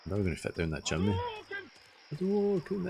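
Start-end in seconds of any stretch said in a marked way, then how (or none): 2.1-2.9 shouted move call. Pretend. Shouting a fighting game move.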